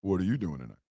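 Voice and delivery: low, soft come-on voice, lightly voiced; a hint of a sultry woman calling; woman's voice.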